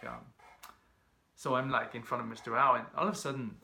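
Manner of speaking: ordinary delivery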